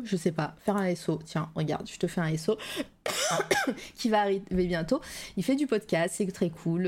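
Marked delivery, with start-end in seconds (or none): 3.0-3.9 short dry cough. Real, not acted. Streamer coughing before greeting her chat, sharp cough. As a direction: clear the throat with a cough. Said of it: "j'ai déjà un chat dans la gorge".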